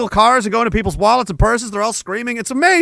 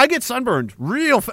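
normal talking voice

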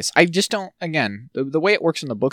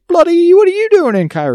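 high, loud British caricature; over-the-top British outburst, pitched way up; mock British exclamation; 9 dB louder than usual. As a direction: exclaim it in exaggerated British surprise. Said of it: "talks like a British person".

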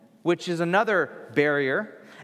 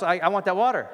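normal talking voice

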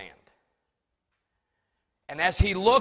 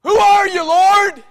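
Saul's question, higher and louder, called out; cry out; 11 semitones higher, 16 dB louder than usual. Acted. Saul on the sand calling up to the voice, loud cry. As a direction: call it up to the light in awe.

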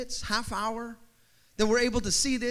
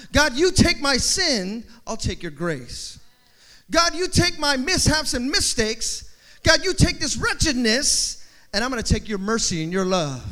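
fervent prayer; 5 dB louder than usual. Acted. Voicing a prayer of exchange.

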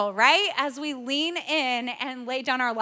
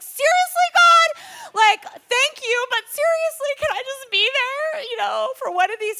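very high, loud mock outrage; exasperated protest; 11 semitones higher, 8 dB louder than usual. Mother hears she is missing daughter's baptism, comically exasperated. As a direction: wail at God in comic exasperation.

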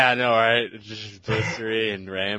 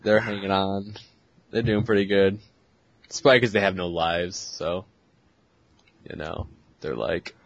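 normal talking voice

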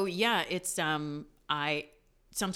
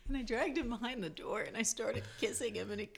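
laughing; 6 semitones higher, 7 dB louder than usual. Real, not acted. Laughing through the retelling.

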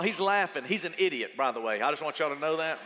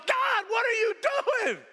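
panicked shout; 11 semitones higher, slower than usual. Retold or imitated. Terrified passenger crying out to God mid-turbulence, high panicked shout. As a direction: cry out to God like the plane is going down.

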